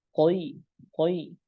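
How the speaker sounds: "qoi" said with a full, thick jaw, the wrong way. Shown as a demonstration. Teacher showing students the wrong thick qoi. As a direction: show the wrong heavy "qoi" to the class. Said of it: "bukan caranya seperti".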